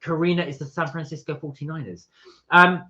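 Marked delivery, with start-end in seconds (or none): 2.5-2.8 mock British accent. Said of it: "He was trying to do a British accent there".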